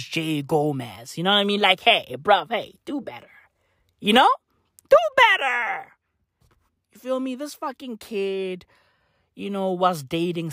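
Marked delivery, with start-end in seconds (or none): 4.9-5.9 scolding call-out. Acted. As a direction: shout it like a fed-up big brother. Scolding shout to the kid.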